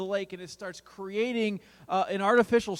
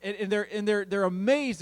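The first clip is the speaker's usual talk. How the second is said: ordinary talk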